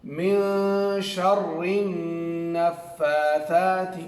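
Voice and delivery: very slow, drawn-out tajweed recitation of the example; Quranic recitation. Shown as a demonstration. Teacher recites the board example for the pupils, slow and drawn out. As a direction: recite من شر النفاثات slowly, every letter held and articulated. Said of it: "ایک مثال لے لیتے ہیں".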